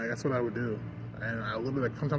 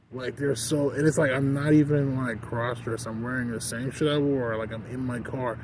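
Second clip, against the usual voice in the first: ordinary delivery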